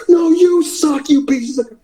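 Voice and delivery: high, drawn-out mock taunt; heel yelling back. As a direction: hurl it back at hecklers, slow and high. Yelling back at heckling fans.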